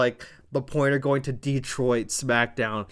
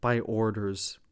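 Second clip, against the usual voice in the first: menacing low quote; 4 semitones lower, 6 dB quieter than usual. Retold or imitated. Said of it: "And Tonga leans in and says".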